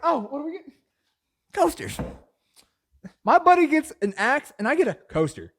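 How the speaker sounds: high, loud, hammed-up disbelief; indignant outrage. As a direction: squawk at being cheated on the gift. Acted outrage at lesser gift.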